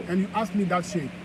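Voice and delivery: ordinary talk, nothing marked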